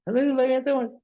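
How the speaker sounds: put-on child showing teacher his math homework, eagerly proud; proud seven-year-old. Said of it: "And I just went".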